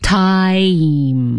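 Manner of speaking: "Time" held long through the vowel; slow repetition. Shown as a demonstration. Host repeating after the teacher, drawn out. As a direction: draw out "Time" carefully like a learner copying. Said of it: "要慢慢地练".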